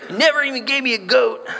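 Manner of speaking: a hint of a sulking teenager; whiny kid to dad. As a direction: complain to your dad like a grumbling kid. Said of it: "I'm gonna lob that one to my dad".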